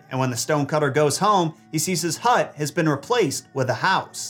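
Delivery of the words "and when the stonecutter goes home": ordinary delivery, nothing marked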